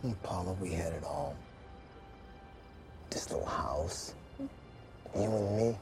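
soft, low, lingering words; tender reminiscence. Acted. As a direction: murmur fondly to your late wife, slowing as you go. Tender reminiscence with deceased wife.

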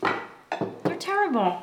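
chewing sticky toffee. Real, not acted. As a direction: mouth glued with pancake. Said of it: "I can't open my mouth".

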